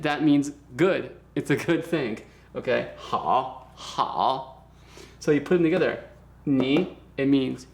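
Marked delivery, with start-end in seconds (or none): 3.0-4.6 "Hao" dropped low and dipping; low, drawn-out teaching "hao"; third tone. Shown as a demonstration. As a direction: draw out "hao" with a deep dip. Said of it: "here is the third tone".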